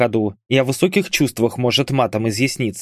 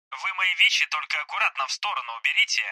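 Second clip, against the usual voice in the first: soft, higher, thin phone-filtered voice; voice on speakerphone; 5 semitones higher, 7 dB quieter, faster than usual. Acted. Hospitalised soldier reporting to his comrades, telephone voice. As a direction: talk as if coming through a phone speaker. Bars heard over speakerphone.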